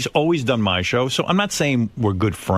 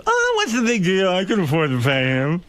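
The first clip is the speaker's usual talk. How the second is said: imitating Jay; 5 semitones higher than usual. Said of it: "He goes".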